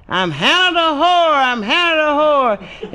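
high squawking parrot holler; shrill-pitched parrot squawk. Acted. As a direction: screech it like a parrot. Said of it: "parrot started hollering".

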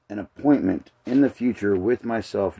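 ordinary talk

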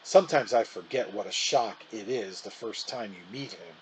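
ordinary delivery

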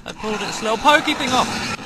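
loud, high panicked shouts; attacked and yelling in panic. Real, not acted. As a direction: fight it off, yelling.

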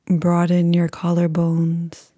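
calm, unhurried meditation voice with long pauses; guided meditation. Acted.

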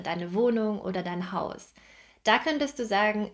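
ordinary talk, nothing marked

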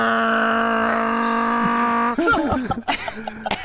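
high, loud cackling laugh; burst of laughter. Real, not acted. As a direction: crack up loudly at the memory. Laughing at own funeral prank.

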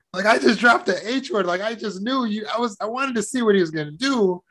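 put-on high, puzzled kid's reasoning; baffled five-year-old. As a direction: think aloud like a bewildered child. Child's baffled thoughts, acted out.

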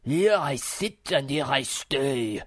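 slow, loud, ponderous philosopher voice; stubborn muskrat. Acted. Philosopher refuses to budge for the maiden, stubborn. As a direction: declare it slowly and loudly, immovable.

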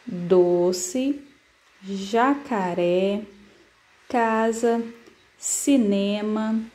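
words read slowly and clearly one by one; reading aloud slowly. Shown as a demonstration. Teacher reads the word list aloud to first graders, slowly. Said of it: "a Pro vai ler algum aqui pra vocês".

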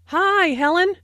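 long stretched Hi, high and bright with delight; pleased to see her. Acted. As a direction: draw out the Hi, glad to see Helen. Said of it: "the I sound in hi is extended to show that Jane is very pleased".

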